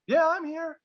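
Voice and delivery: high, drawn-up answering call; calling back. Acted. Drunk stranger answers from the dark, high mock call. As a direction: call back from a distance, acted lightly. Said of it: "he hears the call back".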